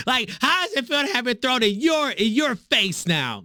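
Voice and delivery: high falsetto mimicry; mocking woman's voice. Host parroting the clip's speaker, mock-womanly high voice. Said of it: "That person sound just like a woman".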